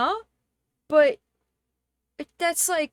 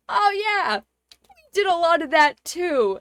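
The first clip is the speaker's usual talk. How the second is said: cheery tourist; 4 semitones higher, 7 dB louder than usual. Retold or imitated. Tourists cheerfully admit slavery.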